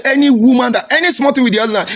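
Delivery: father's warning, high-pitched, a touch comic; imitating father. Son retells his father's warning in a high mock voice. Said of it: "He said".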